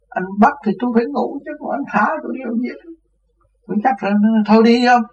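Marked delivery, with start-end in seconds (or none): 0.1-2.7 much higher mock-indignant reply; a prisoner cheekily answering the police; cheeky retort. 4.5-5.0 much higher, louder snap; a policeman ordering the prisoner to leave; exasperated shout. Retold or imitated.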